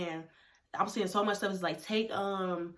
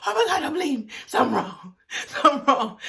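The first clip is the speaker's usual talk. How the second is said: higher, louder panicked cry with a laugh; 6 semitones higher, 6 dB louder than usual. Retold or imitated. Girl panicking at seeing blood, mock-frantic. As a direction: sound the alarm, half laughing at yourself. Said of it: "I panicked".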